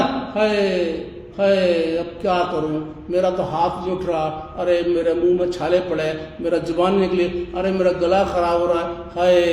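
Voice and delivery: mock self-pitying wail; mock moaning and groaning. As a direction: imitate a self-pitying complainer, half sung. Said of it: "क्यों करते हो हाय ऐसा".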